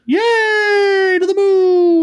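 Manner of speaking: high, loud whoop of celebration; cheering. Acted. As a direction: cheer like a crowd at liftoff. Cheering the arrival.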